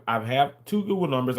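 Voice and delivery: ordinary talk, nothing marked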